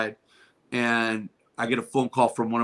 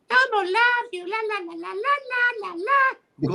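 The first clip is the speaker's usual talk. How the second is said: very high squeaky Elmo; sing-song like Elmo to a child. Retold or imitated. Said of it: "in your Elmo voice".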